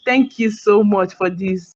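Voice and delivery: ordinary talk, nothing marked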